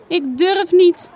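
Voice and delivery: slow, high, loud tearful cry; a scared little girl sobbing it out. A narrator's reading. Janna runs crying to mama, slow tearful sob. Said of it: "snikt ze".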